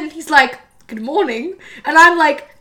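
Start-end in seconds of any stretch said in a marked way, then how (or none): 0.9-1.5 imitating a professor's British accent. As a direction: greet casually in a put-on British accent. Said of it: "Your British accent".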